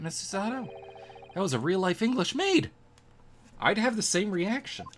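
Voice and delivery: high, loud delighted exclamation; excited. Real, not acted. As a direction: gush about meeting a real maid. Excited over real English maid.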